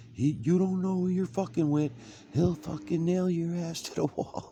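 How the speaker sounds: shrill high, loud mock wife yelling. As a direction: shriek like the wife in the background. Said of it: "she's yelling in the background".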